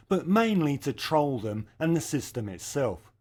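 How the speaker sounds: ordinary talk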